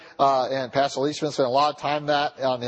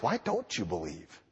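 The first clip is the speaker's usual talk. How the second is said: hushed question; 11 semitones lower, 10 dB quieter than usual. Acted. Preacher repeats question.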